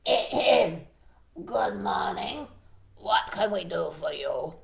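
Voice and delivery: throat-clear, then slow, formal frog voice; slow, official-sounding frog greeting; chief frog. Acted. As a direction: speak up as the leader of the frogs, drawn out. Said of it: "finally the chief frog spoke".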